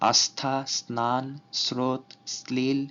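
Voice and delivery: আস্থা স্নান স্রোত শ্লীল slowly with a dental s. Shown as a demonstration. Teacher to students, slowly modelling dental s. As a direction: give each word a slow dental s. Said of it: "দন্ত স এর মতো উচ্চারিত হয়".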